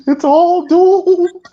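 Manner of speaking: high, very slow doom warning; slow ominous raspy drawl. Retold or imitated. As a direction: stretch out doomed like a prophet of doom. Echoing the doomsayer line.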